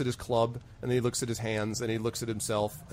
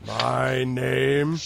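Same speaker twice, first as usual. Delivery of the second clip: puzzled troll; 4 dB louder than usual. Acted. GM voices troll asked his name.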